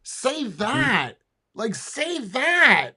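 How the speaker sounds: drawn-out, high stress on "that"; emphatic urging. Real, not acted. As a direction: urge it slowly, "that" swooping high then falling. Urging the speaker on screen.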